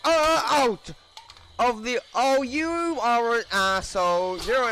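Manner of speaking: loud furious shouting; raging gamer. Real, not acted. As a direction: yell at them in rage. Raging at the players.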